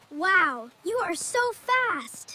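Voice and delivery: ordinary talk, nothing marked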